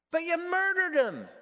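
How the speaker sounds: high, loud outraged accusation; shocked accusation. Acted. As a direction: accuse him in loud disbelief. Ghost accuses the murderer.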